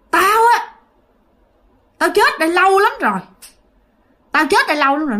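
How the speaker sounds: a ghost speaking through a woman, loud and high; possessed man's voice. Retold or imitated. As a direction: growl it out like an angry spirit possessing her. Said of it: "bây giờ bả nói chuyện ra giọng đàn ông". (giọng hồn ma đàn ông nhập xác)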